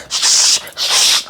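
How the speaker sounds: sharp whooshing "shh" sounds; imitated slashing noise. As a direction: mimic quick blade swipes with hissed air. Imitating slashing while recapping killings.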